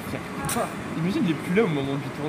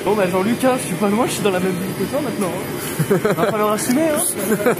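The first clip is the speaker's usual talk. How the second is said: playful threat; 5 semitones higher, 8 dB louder than usual. Pretend. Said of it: "[name] le menace".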